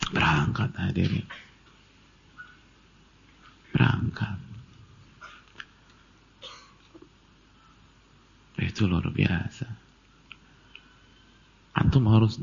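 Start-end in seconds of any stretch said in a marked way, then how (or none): 0.1-9.7 very soft, spaced-out phrases; hushed awe. Real, not acted. Preacher telling the congregation the pilgrim departed, hushed awe. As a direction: drop to a hush and let it sink in. Preacher awed at the story's outcome.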